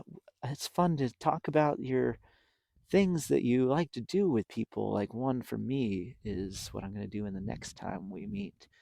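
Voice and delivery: ordinary delivery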